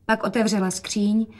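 usual speaking voice

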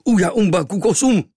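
loud, high wild shriek; tattooed native yelling to frighten the boy; frightful yell. Acted. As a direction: scream to scare him. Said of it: "příšerné zaječení".

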